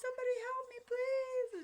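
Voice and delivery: very high, loud desperate scream; retold scream for help. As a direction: re-enact screaming for rescue, drawing out the plea. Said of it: "I'm screaming".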